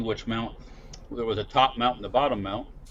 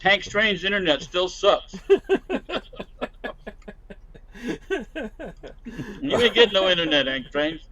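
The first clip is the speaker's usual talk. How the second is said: mock announcer voice; 9 semitones higher, 3 dB louder than usual. Teasing a co-host about his internet.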